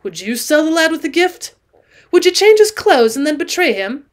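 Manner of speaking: stern. A narrator's reading. Allen accusing James of betraying the lad, sternly. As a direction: press each question as an accusation. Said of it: "cried Allen sternly".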